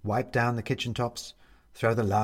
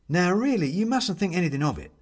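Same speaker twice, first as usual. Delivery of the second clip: bright, higher, quick chatty host voice; cheerful Bobs; 8 semitones higher, 4 dB louder than usual. Acted. Bobs reassuring the ghost guest, cheery and quick. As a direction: fuss over the guest kindly.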